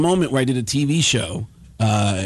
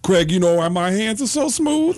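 higher put-on comic's voice; Tracy Morgan; 6 semitones higher than usual. Star comic springs a random question, Tracy Morgan impression. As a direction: imitate an eccentric comic mid-conversation. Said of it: "Tracy Morgan looks at me like we were in mid-conversation and goes".

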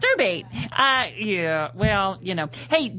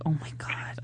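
whispering; 4 semitones lower, 9 dB quieter than usual. Retold or imitated. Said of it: "I used to think".

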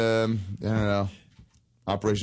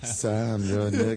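lower, mock-seductive impression; Ron; 5 semitones lower, slower than usual. Shown as a demonstration. Comedian doing his impression at Sam, low and sexy. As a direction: play it sultry, pitched down. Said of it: "That's my sexy Ron".

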